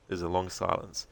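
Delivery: usual speaking voice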